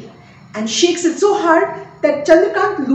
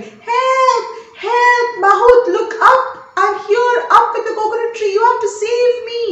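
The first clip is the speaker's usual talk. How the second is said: high, loud panicked cries; man dangling from tree yells to the mahout; yelling for help; 10 semitones higher, 5 dB louder than usual. Acted. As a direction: shout up from the treetop for rescue. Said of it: "Chandrakant starts yelling".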